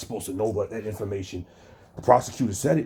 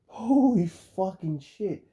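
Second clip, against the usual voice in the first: slow, drawn-out higher stunned holy fucking shit; stunned disbelief; 6 semitones higher, much slower than usual. Real, not acted. Streamer reacting to a guest calling himself a legal expert, drawn-out disbelief. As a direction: let it sink in, disbelieving.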